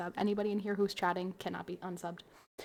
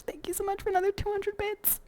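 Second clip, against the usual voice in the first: much higher and louder, gushing; excited thanks; 12 semitones higher, 6 dB louder than usual. Real, not acted. Streamer thanking for two hundred bits, excited. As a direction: burst out with delight.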